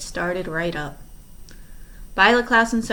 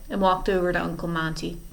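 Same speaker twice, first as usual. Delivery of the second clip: ordinary delivery, nothing marked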